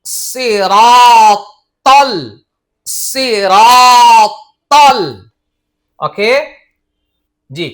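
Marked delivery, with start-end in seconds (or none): 0.1-5.0 সিরাতল said as one word, very slow and louder; joined recitation. Shown as a demonstration. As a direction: chant সিরাতল with tal joined on. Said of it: "তল বলতে হবে".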